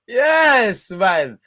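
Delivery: high, loud triumphant yes; celebrating. Real, not acted. Cheering Arsenal players after the win, a loud celebration.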